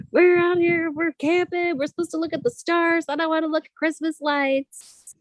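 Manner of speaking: high-pitched whiny husband impression; upset husband. Wife retelling her husband's camping complaint, mock upset. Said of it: "poor Ben was so upset. He was like".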